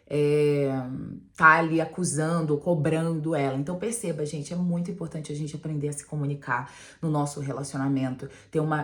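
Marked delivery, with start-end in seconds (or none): none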